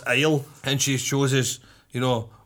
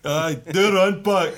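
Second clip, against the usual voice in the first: slow, higher-pitched mock moan; imitating weary grumbler; 4 semitones higher, slower than usual. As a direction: drag it out like a fed-up driver. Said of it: "Aye, that was good".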